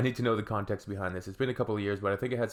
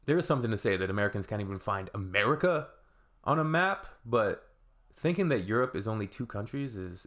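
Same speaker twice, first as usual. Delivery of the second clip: ordinary talk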